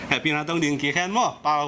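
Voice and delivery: amused aside between bursts of laughing. Real, not acted. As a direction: talk through real laughter. (nui kawm in)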